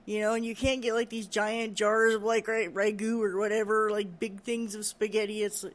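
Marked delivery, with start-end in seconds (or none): none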